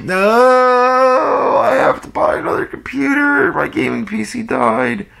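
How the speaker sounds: imitating a little whimper of despair; mock upset wail. Gamer retelling his dead PC, in a hammed-up whiny wail. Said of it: "And I was so upset".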